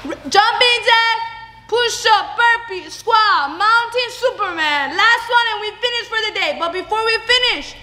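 high, upbeat workout calls; fitness coach. Acted. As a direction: pump the class up. Leads workout round.

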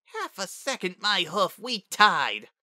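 put-on high, indignant child's protest; grumbling. A narrator's reading. Young Spitfire disputing the result, grumbling. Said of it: "she grumbled".

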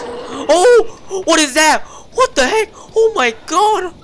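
high, loud frightened shrieks; startled scream. Real, not acted. Player shrieking when a strange creature appears. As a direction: yelp at the jumpscare.

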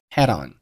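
"head on" for had on, joined as one; linked words. Shown as a demonstration. Teacher showing learners linking, "head on" run together. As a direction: slide the d into on, "head on". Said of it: "had和on连读成了".

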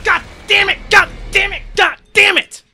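screaming at the screen in rage; full-throated gamer rage; furious shouting. Real, not acted. Raging at the game.

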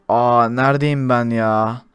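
slow, low and quiet, just waking; groggy. Acted. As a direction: mumble as if waking up.

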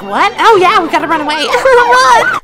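laughing panic. Retold or imitated. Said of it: "we were like".

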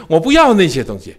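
ordinary talk, nothing marked

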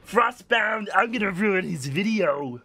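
high, loud sneering mock voice; hammed-up taunt in a mocking voice; mocking impression. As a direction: mimic the smug opponent with scorn. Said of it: "and he's like".

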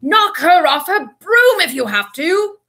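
loud, high, drawn-out order; roar. A narrator's reading. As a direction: bellow it slowly across the pitch. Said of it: "Wood roared".

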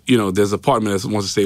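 normal talking voice

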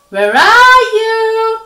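long, high singsong holler; drawn-out searching call. A narrator's reading. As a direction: stretch it out loudly to someone out of sight. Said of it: "he called".

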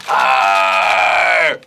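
loud puppet roar; angry dinosaur roar. Acted. Puppet dinosaur roaring at creatures, loud angry roar. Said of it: "a very angry dinosaur".

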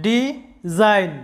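ডিজাইন split slowly into ডি and জাইন; syllable by syllable. Shown as a demonstration. Teacher sounding out a word for students, very slow. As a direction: draw out ডিজাইন in two clear beats. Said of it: "শব্দটা দুইবারে উচ্চারণ করতে হয়".

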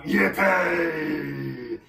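loud, gleeful cheer; high celebratory shout; whooping cry. A narrator's reading. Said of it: "the tees cried out".

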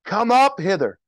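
loud, high commanding call; yell. Acted. Christ calling the saved up to the clouds, yelling. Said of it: "I'm gonna yell".

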